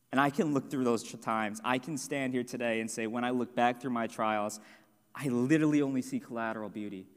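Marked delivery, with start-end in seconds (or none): none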